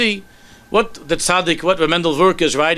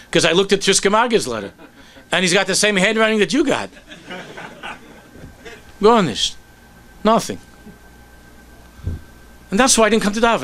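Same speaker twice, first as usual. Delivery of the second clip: higher, rising, agitated complaint with a nervous laugh; hurt outburst; 7 semitones higher than usual. Acted. Mailman blurting his grievance to the Rebbe, higher and agitated. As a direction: let the hurt spill out high and fast.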